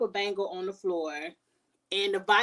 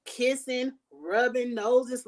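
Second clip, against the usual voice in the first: slow drawn-out mock; 3 semitones higher, much slower than usual. Mocking the couple's nose rubbing.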